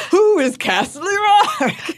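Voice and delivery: high, drawn-out mock-bewildered wail; baffled outcry. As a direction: act out exaggerated, long-held confusion. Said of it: "I kept being like".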